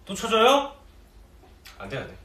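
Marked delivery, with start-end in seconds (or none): none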